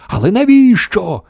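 high, alarmed yelp of a question; panicked group cry; screamed chorus. A narrator's reading. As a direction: demand it in a high squeal. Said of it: "заверещали всі хором".

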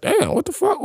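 high, soft, stunned; shocked disbelief. Retold or imitated. Shocked inner reaction retold.

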